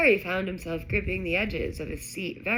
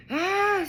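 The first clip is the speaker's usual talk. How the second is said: loud, high cry of alarm; startled scream; high yelp; 13 semitones higher, 6 dB louder than usual. A narrator's reading. Ron as the branch dents his door, yelping. Ron's car punched by attacking tree.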